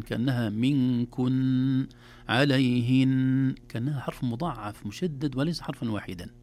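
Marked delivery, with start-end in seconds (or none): 0.5-3.5 "منكن، عليهن" with a long humming ن; doubled final ن. Shown as a demonstration. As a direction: draw out the closing ن of "منكن، عليهن" slowly. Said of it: "لو أطلنا فيها فهي حرفان". ("منكن، عليهن" بنون مشددة مطولة)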